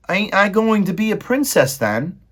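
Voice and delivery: put-on high, loud servant-girl voice; Meg's voice. Maid Meg answering Roscuro, high and loud. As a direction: squeak it out as the simple maid.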